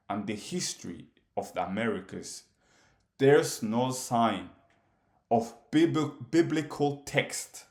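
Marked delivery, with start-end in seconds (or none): none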